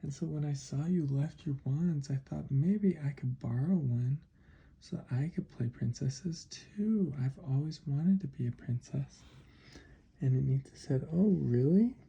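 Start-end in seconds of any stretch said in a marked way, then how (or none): 0.0-9.2 wistful little mouse. Acted. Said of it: "And the mouse said". 11.1-12.0 higher, louder reaction; loud, high-pitched oh-really; pleasantly surprised. Acted. Anita reacts to mouse's wish.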